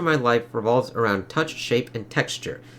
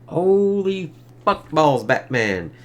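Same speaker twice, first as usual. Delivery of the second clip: mock astonishment; 4 semitones higher, much slower than usual. Reacting to a creepy comic page.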